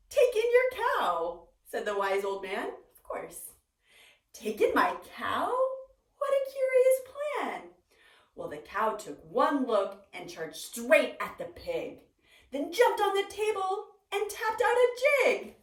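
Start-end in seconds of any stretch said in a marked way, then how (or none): 0.1-1.5 high, slow, loud proclamation; booming wise old man. Acted. 2.7-3.5 unvoiced knowing whisper; whispered aside. Real, not acted. 4.3-7.7 leaps high on What in disbelief; baffled old lady, rising shock; astonished old lady. Acted. 8.3-15.6 builds louder and higher; rising into the chaos; excited narration. A narrator's reading.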